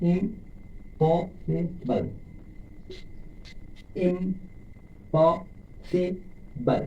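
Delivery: Impossible very slow, syllables apart. Shown as a demonstration. Teacher demonstrating four syllables, impossible slowly. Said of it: "চারবার স্পর্শ করছে".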